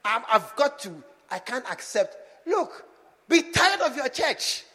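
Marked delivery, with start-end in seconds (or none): 2.5-4.6 urgent exhortation. Real, not acted. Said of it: "I said, be tired of your church".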